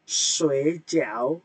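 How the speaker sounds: "Sui jiao" with a dipping tone, drawn out; nada ketiga. Shown as a demonstration. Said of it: "nada tiga". ("Sui jiao" nada tiga, rendah lalu naik)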